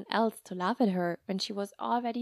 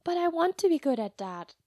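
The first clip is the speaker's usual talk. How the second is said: put-on sulky little girl; 7 semitones higher, 4 dB louder than usual. Sulky child voice.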